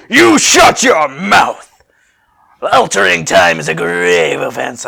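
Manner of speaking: slow, loud barked warning; a future warrior scolding hard; stern Trunks voice. Acted. Trunks glaring at Nani, a stern loud bark. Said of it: "I can't get his voice right at all".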